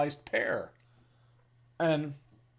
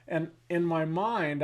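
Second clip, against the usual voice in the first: ordinary talk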